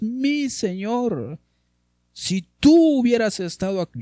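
high, slow wailing plea to the Lord; weeping plea. Acted. María weeping at Jesus' feet, high pleading wail. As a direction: let it break like a sister mourning.

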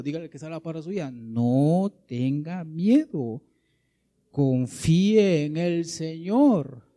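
loud, stretched-out proclamation; forceful preaching. A narrator's reading. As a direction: proclaim it to the congregation, holding key words long.

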